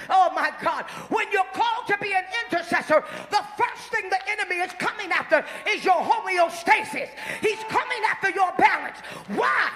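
loud, rising sermon shouts; full-force preaching. Acted. Preacher shouting to the congregation to talk back.

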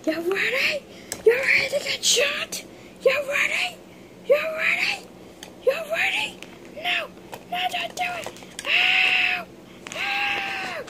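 very high squeaky cries; squealing figure. Pretend. Figure shot, escalating squeals.

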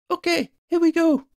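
very high-pitched go-ahead; high, keyed-up young voice; high urgent friend. A narrator's reading. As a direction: call it out high before the plunge. Friend readies a broom manoeuvre.

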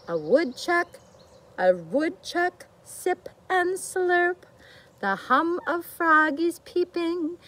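lively storybook reading; reading to children. A narrator's reading. Teacher reads the bear book to children, storybook voice. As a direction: read it out for a room of little ones.

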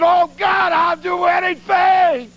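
desperate prayer. Retold or imitated. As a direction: beg God at the top of your voice. Desperate prayer in toilet stall.

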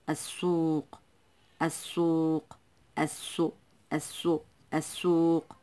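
السوق with no l, a long doubled s; silent lam. Shown as a demonstration. Teacher showing the silent lam, السوق with doubled s. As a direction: hold the hissed s in السوق. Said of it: "إذن اللام لا تنطق".

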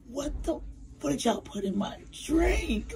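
mock disbelief. Imagined text to a friend.

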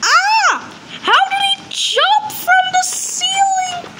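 startled by the sudden ambush; shocked scream. Real, not acted. Piggy jumps from ceiling.

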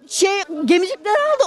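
usual speaking voice

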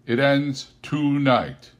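high, loud, slow solemn spirit; slow ghost voice. Acted. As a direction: draw out a grave ghostly warning. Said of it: "replied the ghost".

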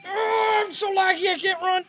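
high panicked cry while fleeing; frantic, scared gamer fleeing. Real, not acted. Chased by monster in game.

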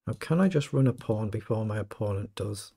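usual speaking voice

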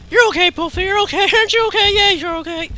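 high, loud, hurried coddling of a pet; cooing reassurance. Pretend. Reassuring a frightened game creature.

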